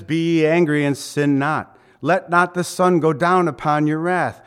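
loud, solemn scripture quote; scripture reading. Acted. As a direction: proclaim the verse. Quoting scripture.

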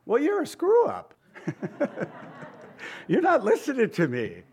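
high, snide put-down voice with a laugh; mocking God's retort. Preacher mocks what God might snap back, high and snide. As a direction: toss back the attitude in a high, scornful voice. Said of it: "copped your attitude and tone of voice".

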